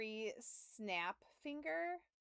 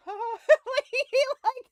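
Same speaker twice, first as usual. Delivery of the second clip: imitating his high nervous giggle, much higher and louder; nervous laugh; 17 dB louder, slower than usual. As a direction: mimic the nail tech's jittery laugh. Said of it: "had the nervous laugh and everything".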